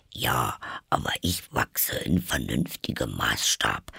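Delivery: low, soft creature voice; deep muted Dormouse; grumpy Dormouse. Acted. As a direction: object in a deep, subdued animal grumble. Narrator plays Dormouse.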